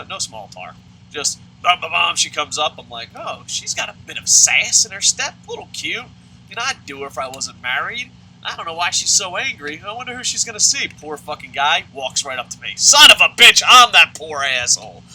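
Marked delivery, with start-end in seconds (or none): 3.1-10.9 high, louder mock-admiring musing; oblivious onlooker. Teacher eyeing the woman walking up, hammed-up amusement. Voices his thoughts as she approaches. 12.8-15.0 loud, high comic outburst; exasperated realization. Acted. Teacher realizing he is her target, exasperated shout.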